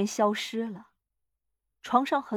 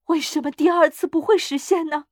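high, loud, feverish question; impassioned, raised insistence; fervent; 6 semitones higher, 6 dB louder than usual. A narrator's reading. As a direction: demand it with wild eagerness. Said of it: "老妇人狂热地说".

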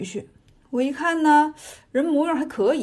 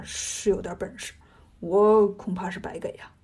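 lowered, half-whispered admission; cowed undertone; 6 semitones lower than usual. A narrator's reading. Monster admits fear of his rival, cowed half-whisper. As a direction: admit it under your breath, nearly voiceless.

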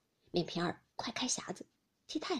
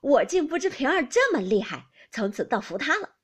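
loud, lifted, amused admiration; delighted amazement; 4 semitones higher, 9 dB louder than usual. A narrator's reading. Husband applauding the maid's defiance, loud amused delight. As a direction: crow with delight at the maid's nerve. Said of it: "拍手笑道".

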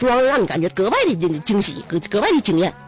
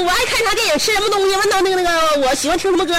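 higher imitation of the friend; mock friend boasting; 9 semitones higher, faster than usual. Friend boasting she knows everything about him, higher mock voice. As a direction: list it off in her higher voice.

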